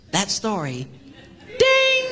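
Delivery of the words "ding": a sharp, high, louder "Ding!" like a bell; high ding sound. Retold or imitated. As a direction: ring out a point like a game-show bell. Imitating a point bell.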